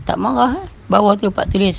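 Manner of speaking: normal talking voice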